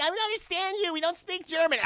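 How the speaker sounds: put-on high, helpless girly voice; mock confused girls. Mocking the girls to the German man, high confused. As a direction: sound lost like a clueless tourist. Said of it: "They're like".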